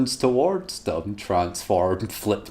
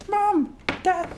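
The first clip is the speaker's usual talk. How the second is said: put-on very high child voice; high girl voice. A teen daughter addresses her parents, high girl voice. Rose voiced, wrong take.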